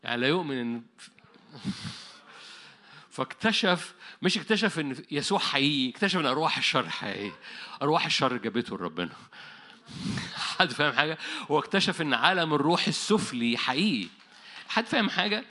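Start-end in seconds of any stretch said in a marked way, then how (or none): none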